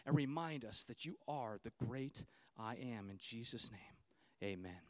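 soft, low pastor's prayer; hushed congregational prayer. Acted. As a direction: pray quietly and humbly before God. Leads a prayer.

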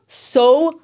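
ordinary talk

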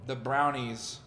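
ordinary talk, nothing marked

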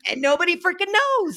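fed up with family dinner, exasperated. Real, not acted. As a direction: throw up your hands about it. Venting about picky kids.

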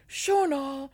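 put-on high, loud Irish-accented call of a sister's name. Older sister calling her sibling in Irish accent. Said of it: "my attempt at an Irish accent".

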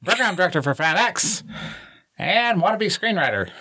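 high, fast announcer voice; old-timey radio announcer. Pretend. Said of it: "introduce yourself like that real quick".

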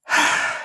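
short audible sigh. Acted. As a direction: let out a breathy sigh. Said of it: "She sighed".

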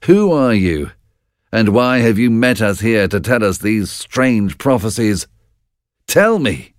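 lightly voiced warrior, puzzled and insistent; puzzled Macbeth. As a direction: demand answers from the witches. Macbeth questions the witches.

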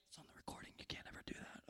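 hushed whispered aside; whispered disclaimer. Real, not acted. Speaker covering himself for the recording, whispered aside.

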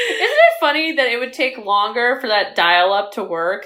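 ordinary talk, nothing marked

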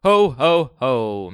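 booming, slow Santa laugh; jolly ho ho ho. Pretend. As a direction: belt it out like Santa Claus. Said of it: "was going to do a ho ho ho in the opener".

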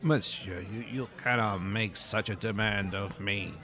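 indignant French waiter. Acted. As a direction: refuse like an offended French waiter. Pettiford refuses.

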